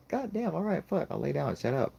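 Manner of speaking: high-pitched grudging giving in; exasperated surrender. Retold or imitated. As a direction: cave in after being screamed at. Dad gives in to screaming.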